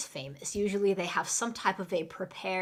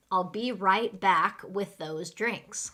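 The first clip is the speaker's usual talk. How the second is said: slow, carefully spaced words; slow model sentence; slower than usual. Shown as a demonstration. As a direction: say it slowly for learners. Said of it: "let's go through and say them really fast".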